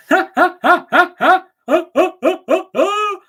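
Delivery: high, loud mock cackle imitating the captain; ha-ha it back at him, jeering; mocking laugh. Fan mimics captain laughing off missed free kick, mocking laugh. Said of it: "And you sat there".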